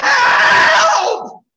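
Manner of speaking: high-pitched yell building to a long scream; screamed call for help. Shown as a demonstration. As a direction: demonstrate a full-volume scream for rescue. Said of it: "How would you scream if you were really stuck?".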